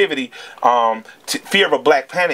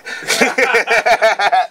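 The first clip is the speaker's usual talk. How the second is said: real, higher-pitched laugh; 8 semitones higher than usual. Real, not acted. Father laughing after a story about his son, real laugh. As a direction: laugh at your own fond story.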